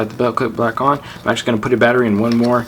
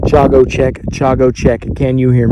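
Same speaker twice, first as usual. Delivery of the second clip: loud, carrying radio call to a distant friend; louder radio hail across half a mile; calling over radio; 2 semitones higher, 7 dB louder than usual. Real, not acted. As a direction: project it into the walkie-talkie.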